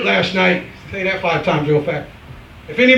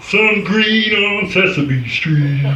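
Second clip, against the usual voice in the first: Kermit the Frog; 3 semitones higher, much slower than usual. Retold or imitated. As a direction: sing out the greeting like the green frog. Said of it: "Kermit the Frog".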